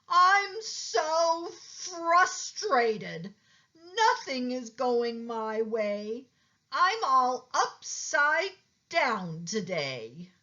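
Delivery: high, very slow drawn-out whine; frustrated turtle. A narrator's reading. Turtle moaning to Pete about his day, high and slow. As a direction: stretch out every word in frustration.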